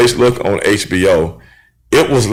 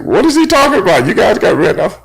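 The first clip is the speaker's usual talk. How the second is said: voicing surprised daughter; 11 semitones higher than usual.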